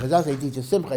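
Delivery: quick correction. Real, not acted. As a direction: fix the slip fast, pushing ahead. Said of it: "I'm sorry".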